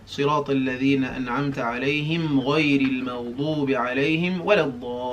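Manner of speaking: fast spoken preview; quick run-through. Shown as a demonstration. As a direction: rattle it off quickly. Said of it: "Now we're gonna do the last ayah".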